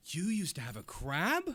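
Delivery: high surprised question; surprised captain. Acted. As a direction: gasp and ask in disbelief. [name] says.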